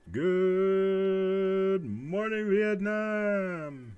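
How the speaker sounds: high, very slow drawn-out DJ call; radio DJ shout. Retold or imitated. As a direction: stretch it out like Robin Williams on air. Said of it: "that's what I'm thinking of".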